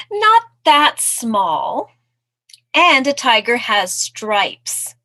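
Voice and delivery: high, loud, slow put-down; emphatic storybook fox; objecting fox. Acted. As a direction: draw out each word to correct the mouse.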